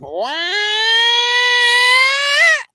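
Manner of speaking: long, high engine wail; imitated engine roar. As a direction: sustain a loud, rising airplane whine. Imitates the plane at takeoff.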